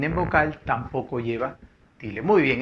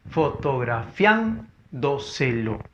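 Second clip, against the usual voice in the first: fotografiándoselo slowly split as fo-to-gra-FIÁN-do-se-lo; syllable by syllable; much slower than usual. Shown as a demonstration. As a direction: sound out fotografiándoselo with pauses, leaning on FIÁN. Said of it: "sería el silabente silabeo". (fotografiándoselo silabeado despacio, con fuerza en FIÁN)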